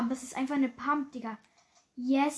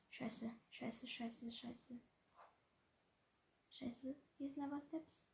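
panicking gamer; 17 dB quieter, slower than usual. Real, not acted. Hearing footsteps while hiding.

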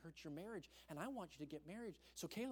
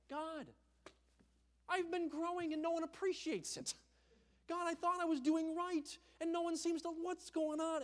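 high, louder, pained cry to God; anguished plea; 11 semitones higher, 6 dB louder than usual. Retold or imitated. Young man driving alone, crying out to God in anguish. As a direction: pour out your hurt to heaven. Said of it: "agonizing, like".

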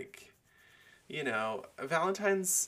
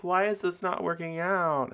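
mock whiny contestant; 9 semitones higher, 5 dB louder than usual. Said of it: "be like".

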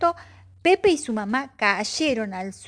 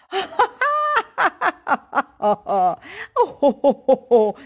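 loud booming belly laugh, higher; bear guffaw; 7 semitones higher, 6 dB louder than usual. Acted. The bear laughs out loud with the whole forest. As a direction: roar with laughter. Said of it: "Dijo el oso a las carcajadas".

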